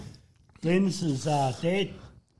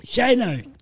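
raised, louder name call; 5 semitones higher, 4 dB louder than usual. Real, not acted. Podcaster calling out to Uncle Shane, raised voice. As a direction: hail him down the microphone.

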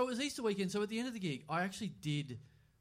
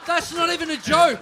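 loud, higher indignant protest; 8 semitones higher, 12 dB louder than usual. Real, not acted. Stung by the age joke, loud indignant protest. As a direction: push back hard, voice raised.

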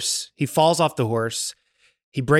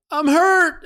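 high, wailing imitation of a wounded man; mock crying. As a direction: cry out pitifully, hammed up. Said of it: "like, crying".

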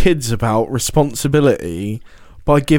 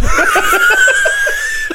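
high laugh. Real, not acted. Laughing at joke.

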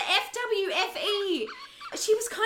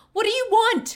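loud, frightened demand; desperate demand; 3 semitones higher, 7 dB louder than usual. Acted. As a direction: shout it at the captor. Demanding answer from captor.

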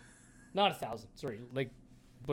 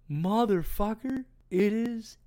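higher and louder, drawn out slowly; stunned excitement; 9 semitones higher, 7 dB louder, slower than usual. Retold or imitated. Hunter seeing elk in his scope, drawn-out high exclamation. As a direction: let disbelief burst out of you.

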